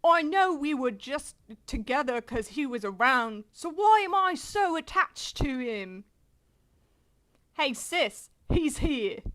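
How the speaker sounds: manga character in put-on British; British accent. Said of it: "do the British voice".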